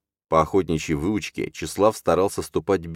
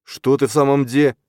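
loud, raised irritated demand; angry, just woken; 10 semitones higher, 6 dB louder than usual. A narrator's reading. Father woken by shouting bursts in, angry. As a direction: burst in cross from sleep, voice raised. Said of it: "злой с просонья".

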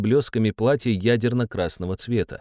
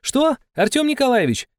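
cheerful shouting. Acted. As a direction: shout gaily over the noise. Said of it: "перекрикивая визг певицы, весело спросила".

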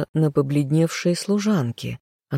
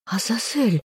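higher, confused woman's voice; bewildered; 7 semitones higher than usual. A narrator's reading. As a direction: say the name in lost confusion. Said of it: "растерянно произнесла любимая".

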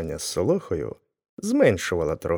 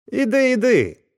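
much higher, louder shout; the blacksmith yelling while he strikes; 5 dB louder than usual. A narrator's reading. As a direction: chase him off. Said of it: "закричав коваль".

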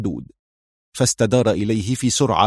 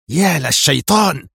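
slow, higher, louder angry curse; angry oath; 6 semitones higher, 3 dB louder than usual. A narrator's reading. Mira watching the guard die, cursing angrily. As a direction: spit the curse out slowly in rage. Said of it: "فغمغمت في غضب".

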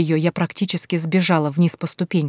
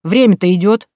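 louder, impatient driver; impatient taxi driver, louder; irritated driver; 2 semitones higher, 7 dB louder than usual. Acted. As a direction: hurry her up, annoyed. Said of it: "раздражённо".

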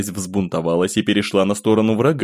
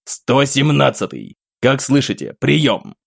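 calling again into the radio, slower; insistent radio hail; repeated radio call; 5 semitones higher, slower than usual. Acted. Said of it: "повторяя запрос". (настойчивый вызов по рации)

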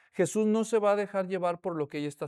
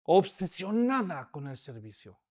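obsessed; 3 semitones lower than usual. Acted. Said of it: "muy acelerada".

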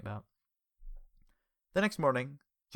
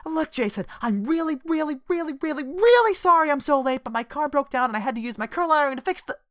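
high, loud breathless apology; flustered rush; 10 dB louder than usual. Acted. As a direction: gush out the excuse, much higher and louder. Flustered late employee rushing in.

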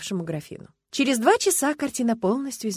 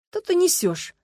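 reproachful; 10 semitones higher, 5 dB louder than usual. A narrator's reading. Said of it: "укоризненно посмотрел на жену Сергей".

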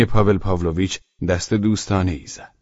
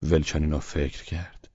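ordinary delivery, nothing marked

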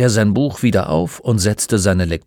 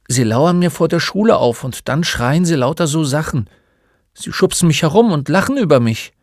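lightly voiced boy, higher-pitched; bullied schoolboy; 7 semitones higher, 4 dB louder than usual. Boy tells the old man about his tormentors, higher-pitched complaint. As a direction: complain like a put-upon child.

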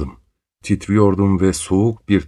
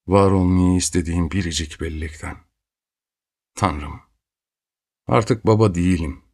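usual speaking voice

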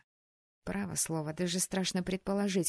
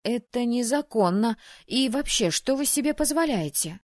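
high, loud, rapid indignation; rattling off; 5 semitones higher, 7 dB louder than usual. Acted. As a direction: fire it off, outraged and loud. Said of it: "затараторила".

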